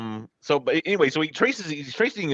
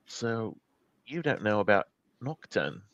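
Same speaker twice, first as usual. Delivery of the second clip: quiet confiding; 2 semitones lower, 7 dB quieter than usual. Acted. Rowan asking Penelope about Nocturne, quietly confiding. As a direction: drop the volume and confide.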